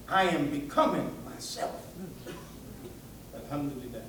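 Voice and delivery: ordinary talk, nothing marked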